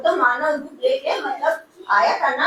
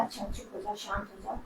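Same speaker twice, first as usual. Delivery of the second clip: much softer, gentle calming words; soothing hush; 5 semitones lower, 17 dB quieter, faster than usual. Retold or imitated. Mother soothes her crying daughter over the phone, softly. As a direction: hush her tears tenderly.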